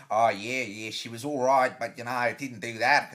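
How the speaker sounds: low, loud mock-Australian accent. Shown as a demonstration. Comic imagining a gig on Australian TV, mock Australian accent. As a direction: put on a bad Australian accent. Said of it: "gig on Australian T V going".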